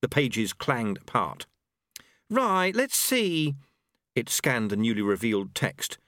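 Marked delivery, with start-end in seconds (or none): 2.3-3.5 high, slow angel voice; busy angel. A narrator's reading. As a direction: draw it out high while scanning a page. Angel reading the book.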